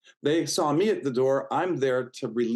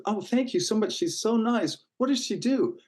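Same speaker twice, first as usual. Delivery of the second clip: much higher, eager thanks and innocent question; bright grateful gush; 10 semitones higher than usual. Retold or imitated. Retelling thanking the friend who referred him, high eager gush. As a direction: gush your thanks, then ask with naive curiosity.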